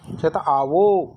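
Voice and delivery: ordinary talk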